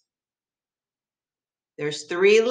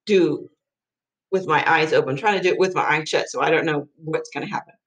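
usual speaking voice

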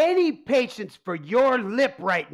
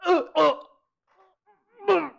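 stammering shock; 7 semitones higher, faster than usual. Acted. Tony choking in shock.

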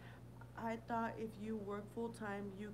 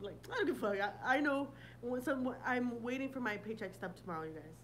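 normal talking voice

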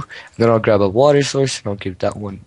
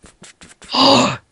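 mock gasp; 13 semitones higher than usual.

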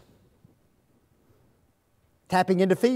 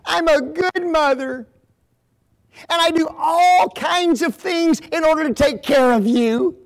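a mother near tears, much higher and louder; hurt pleading mother; 10 semitones higher, 6 dB louder than usual. Acted. Devastated mother pleading with her angry daughter, tearful. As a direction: plead through tears with the child. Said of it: "So she says this".

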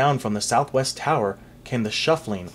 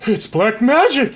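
shriek; 10 semitones higher, 8 dB louder than usual. Acted. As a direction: shriek it out in panic. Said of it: "he shrieked".

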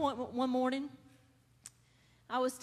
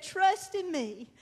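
reassuring Jesus; 3 semitones higher, 7 dB louder than usual. Retold or imitated. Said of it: "And he said".

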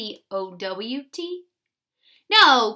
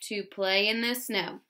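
slow dictation; 3 semitones lower, slower than usual. Shown as a demonstration. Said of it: "Go ahead".

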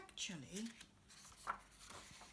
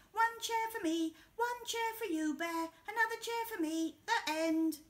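much higher, louder sly Hare; high, smug sharing-out voice; cheeky Hare; 11 semitones higher, 6 dB louder than usual. Acted. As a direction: hand out the chairs grabbing the most. Hare shares unfairly.

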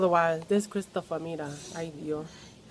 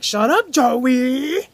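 loud, very drawn-out mock yell; aunt's yell; 5 semitones higher, 10 dB louder, much slower than usual. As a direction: yell it, dragging the name upward. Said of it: "yelling at me".

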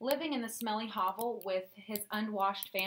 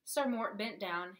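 ordinary delivery

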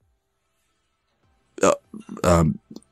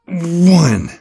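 hyped rep counting; 3 semitones higher, much slower than usual. Acted. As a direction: cheer each rep like a pumped coach. Trainer counting reps for client.

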